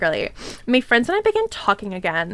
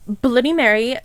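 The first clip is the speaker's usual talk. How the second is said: ordinary delivery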